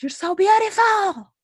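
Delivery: high-pitched mock holler from afar; imitated yell. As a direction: call out admiringly, imitating a catcaller. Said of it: "he yells at me".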